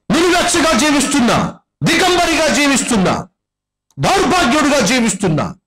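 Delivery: high, ringing preacher's cry building to a peak; fiery preaching. Acted. Preacher warning the sinful congregation, fiery high preaching. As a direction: preach it out to the whole hall. Preacher pleading at full pitch.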